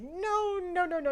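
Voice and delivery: high-pitched mock refusal, sliding down; flustered refusal. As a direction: pile up the refusals, starting high. Retold flustered refusal to friends.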